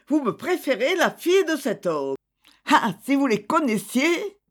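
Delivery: high-pitched, indignant reproach; angry outburst. A narrator's reading. Thérèse reproaches the family for preferring that man's daughter, angry outburst. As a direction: pitch up in a narrated flare of temper, without shouting. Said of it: "avec emportement, cria".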